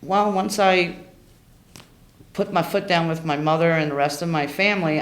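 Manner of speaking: ordinary talk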